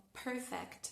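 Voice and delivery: "perfect" en inglés americano, con la R marcada; American accent. Shown as a demonstration. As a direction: di "perfect" como un estadounidense. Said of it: "si queremos sonar más estadounidenses". ("perfect" con acento americano)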